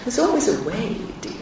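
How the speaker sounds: high, soft, lilting mother voice; imitated mother. Mother answering her stuck daughter's call, soft high imitation. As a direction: gently sing it out as mum would. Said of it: "And she'd say".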